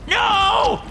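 very high, drawn-out scream. A narrator's reading. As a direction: scream it out in terror as you're dragged in. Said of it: "I screamed".

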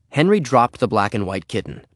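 usual speaking voice

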